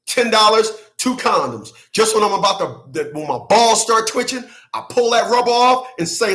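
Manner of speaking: ordinary delivery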